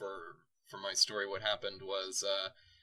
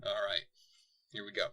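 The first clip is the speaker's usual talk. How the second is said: ordinary talk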